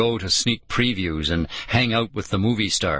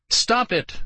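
high, mild scream; 13 semitones higher than usual. A narrator's reading. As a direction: cry out to make them stop. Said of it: "Lauren screamed".

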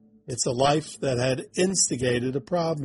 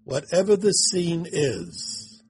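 guided meditation. Acted. Guiding through the scene.

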